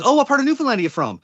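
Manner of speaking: high, chirpy clerk's question. Acted. A store clerk asks the Newfoundlander where he's from, high. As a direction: ask brightly like a shop clerk. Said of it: "the person behind the counter says".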